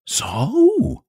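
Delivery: high-pitched, anxious wife; nervous terror. A narrator's reading. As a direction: catch his fright in one word. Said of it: "beginning to share her husband's nervous terror".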